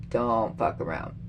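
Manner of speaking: quiet, deadly serious warning. Real, not acted. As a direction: say it low like a last warning. Warning Trump, repeated.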